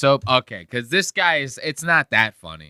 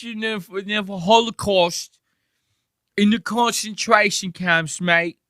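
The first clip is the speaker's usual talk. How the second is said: high, hammed-up British lad; mock British accent; 9 semitones higher than usual. Streamer mocking a British guest, in a hammed-up British accent. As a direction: keep taking the mick in a British accent.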